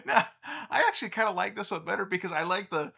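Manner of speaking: high, fast, talking through a laugh. Real, not acted. Telling viewers which image he prefers, laughing. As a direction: still giggling as he speaks.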